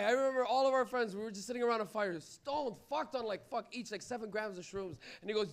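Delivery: ordinary talk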